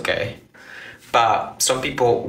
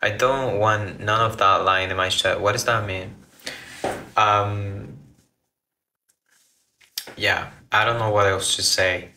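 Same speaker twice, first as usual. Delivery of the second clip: usual speaking voice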